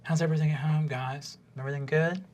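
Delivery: soft, probing counsellor voice; gentle gnome counsellor. Acted. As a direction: check in on them gently and quietly. Game master plays gnome counsellor.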